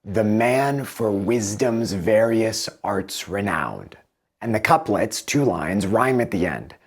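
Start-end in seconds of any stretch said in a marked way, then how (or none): 0.0-4.0 slow iambic beat, stress on "man", "wis", "var", "arts", "nowned". Shown as a demonstration. Teacher showing pentameter, slow and beat by beat. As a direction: tap out the five beats of "The man for wisdom's various arts renowned". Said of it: "the stress is on the second syllable in the beat".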